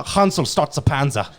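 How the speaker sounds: put-on a lightly done German accent. Friend asked for accents, mild German voice. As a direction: bark a soldier's order in German style. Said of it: "name any accent, I'll try".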